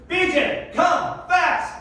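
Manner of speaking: high-pitched sideline holler; coach calling out. Retold or imitated. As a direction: call urgently across the field to a player. Opposing coach calling out his player.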